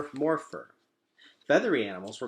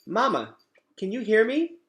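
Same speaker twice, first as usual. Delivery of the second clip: high, loud anxious call; a child calling for a mother who won't answer; worried gosling; 12 semitones higher, 8 dB louder than usual. Acted. Gosling calls to his switched-off robot mother, high and anxious. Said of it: "There was no answer".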